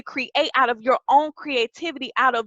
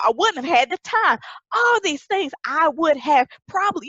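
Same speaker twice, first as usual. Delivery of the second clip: ordinary delivery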